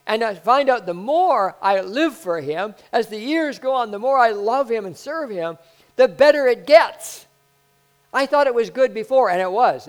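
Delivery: loud, high preaching build; preaching at full force. Acted. Preacher pressing the point to the church, loud and high. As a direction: drive it home to the congregation.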